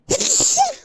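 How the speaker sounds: a sudden loud sneeze; one sharp unexpected sneeze; sneezing. Real, not acted. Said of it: "I apologize for the sneeze".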